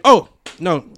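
usual speaking voice

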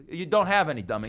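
ordinary delivery

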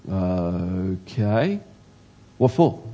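long drawn-out, hesitant okay rising at the end; exaggerated puzzled reply. Replying to a neighbour's unexpected thanks, mock wary puzzlement. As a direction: stretch out okay warily, then question it. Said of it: "I didn't say it like that".

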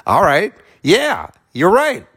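sheepish, amused "you're right"; amused agreement. Retold or imitated. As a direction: give in with a grin. Acting out his own reply.

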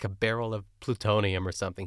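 ordinary talk

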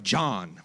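"John" said very low and flat as Philly slang; "John" as a Philly slang word. Shown as a demonstration. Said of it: "They say words like".

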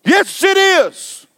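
very high, slow taunting voices; mock the preacher like a taunting spirit, slow and high; taunting demon voices. Preacher imitating demons taunting him, very high slow voices. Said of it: "I would hear".

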